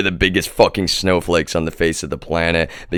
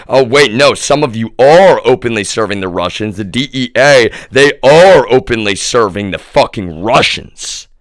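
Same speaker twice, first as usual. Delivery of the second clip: angry outburst; 5 semitones higher, 7 dB louder than usual. Real, not acted. Angry realisation at the authorities.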